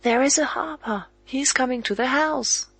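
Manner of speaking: put-on high, loud cry of a child spotting someone; a boy shouting that a harper is coming; excited cry. A narrator's reading. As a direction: call it out to the others, thrilled. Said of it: "Robert suddenly cries out".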